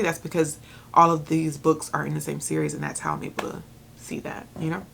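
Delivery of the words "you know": normal talking voice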